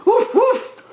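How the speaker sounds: playful woof. Acted. Mother imitates dog for child.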